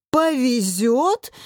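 slow, rising indignant echo; outraged roar. A narrator's reading. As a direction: draw the word out in disbelieving fury. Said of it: "взревел Илья". (возмущённо взревев, растягивая слово)

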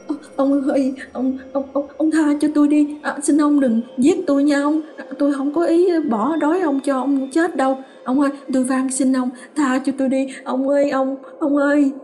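high, stammering plea for mercy; terrified pleading. A narrator's reading. Woman begs her dead husband's ghost, terrified and high. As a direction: beg the ghost, stumbling over every word.

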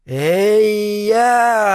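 high, drawn-out call in a strange voice; drawn-out sorcerer's call. Acted. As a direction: stretch it out like the old sorcerer. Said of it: "протянул Кран до невозможности знакомым голосом".

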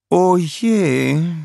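high, worried little sigh; softly troubled old queen; queen's quiet dismay. A narrator's reading. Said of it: "sagte die Königin leise". (leise, besorgte Königin)

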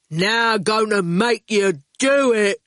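slow, heavy, determined words; blunt digger driver. A narrator's reading. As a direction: gloat with drawn-out resolve. Erskine's character voice.

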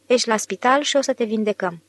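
usual speaking voice

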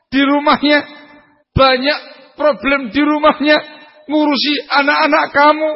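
high, pressing sermon delivery. Acted. Preacher urging husbands to understand their wives, fervent preaching. Preacher describing the wife's burdens.

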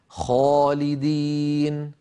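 "خالدين" slow, with a dark, backed long aa; heavy alif. Shown as a demonstration. Teacher demonstrates heavy alif after khaa, drawn out. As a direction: thicken and stretch "خا" in "خالدين". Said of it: "الخاء مفخمة".

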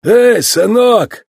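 long, high hail to a youngster; calling out. A narrator's reading. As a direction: veteran calling the rookie over. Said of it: "обратился к нему действующий чемпион".

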